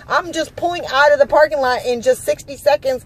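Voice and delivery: high-pitched, exasperated arguing back; indignant outburst. Retold or imitated. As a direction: act out your outraged comeback to the guard. Acted quarrel with the guard.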